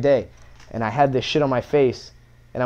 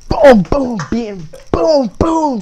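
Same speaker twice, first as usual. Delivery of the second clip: smack sound effects; 11 semitones higher, 8 dB louder, much slower than usual. Retold or imitated. Mom smacking him.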